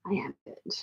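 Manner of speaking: breathy whispered aside. Real, not acted. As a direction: whisper it to yourself. Whispered repeat after croaky remark.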